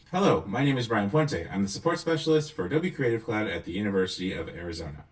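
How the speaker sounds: loud megaphone effect; as if through a megaphone. Shown as a demonstration. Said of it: "this megaphone one".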